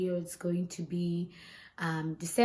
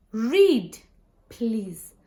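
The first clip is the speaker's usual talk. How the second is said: usual speaking voice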